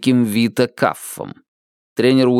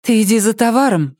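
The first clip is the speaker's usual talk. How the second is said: higher-pitched female voice; put-on high voice of a woman taking charge; woman character; 10 semitones higher than usual. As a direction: give instructions in a raised, womanly pitch. Mary gives Jared instructions.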